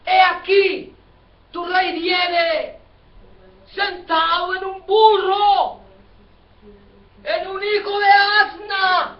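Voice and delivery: high, drawn-out prophetic cry; proclaiming scripture. Acted. Preacher quoting the Bible's prophecy to the congregation, high proclamation. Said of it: "Pero la Biblia dice".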